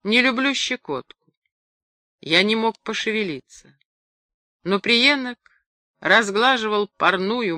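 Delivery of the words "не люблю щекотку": higher-pitched mild whine; lightly voiced complaint; complaining. A narrator's reading. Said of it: "Она пожаловалась".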